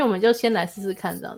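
usual speaking voice

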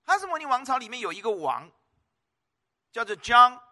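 usual speaking voice

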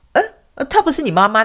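high, startled double-take; retold confusion. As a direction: act out puzzled surprise. Retold confused question to the dog.

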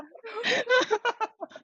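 burst of laughter. Real, not acted. Sister laughing while retelling her brother's meltdown, amused.

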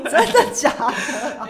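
amused disbelief. Real, not acted. Cohost reacts to crying story.

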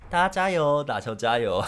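hammed-up sideline cheer; mock cheer. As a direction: pretend to rally the team from the sideline. Imagining being a cheerleader.

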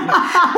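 loud high laugh; real laugh at the messy marriage; burst of laughter. Real, not acted. Laughing at her own story.

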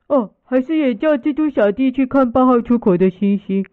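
ordinary delivery

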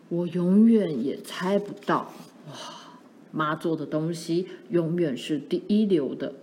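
a hint of dad, slightly low and admiring; lightly voiced admiring father. Father praising mother's cooking after tasting, lightly acted admiration.

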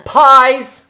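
loud, high-pitched call; page-turning cue. Pretend. Host marks a page turn, loud high call. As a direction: belt out the cue word. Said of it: "page turning sound, obviously gonna be pie".

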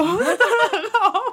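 high, loud laugh bursting out; burst of laughter. Real, not acted. Laughs at own cluelessness. (大笑)

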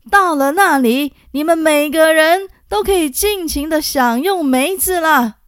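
loud, high rallying call to soldiers; rousing shout to troops. A narrator's reading. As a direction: shout to lift the troops' spirits. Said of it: "大声对将士们说".

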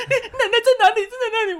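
very high mock wail; frantic tearful questions, hammed up; imitating crying mother. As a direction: imitate a weeping mum begging to know. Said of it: "你在哭什么".

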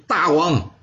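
high, heated pleading that slows on the last question; agitated protest. Acted. Wu Zixu protests to the king, voice high and agitated. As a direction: argue hotly, pitch climbing with frustration. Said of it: "他激动地说".

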